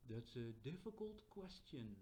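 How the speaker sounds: slow, measured gravity; grave, deliberate AI answer; serious. Shown as a demonstration. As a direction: weigh each word seriously. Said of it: "if it says something serious".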